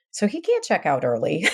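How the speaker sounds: ordinary talk, nothing marked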